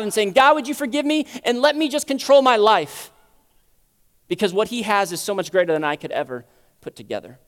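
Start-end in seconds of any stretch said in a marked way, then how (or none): 0.3-3.1 loud, raised-pitch pleading; forceful mock prayer. Preacher voicing the prayer he rejects to God, loud forceful plea.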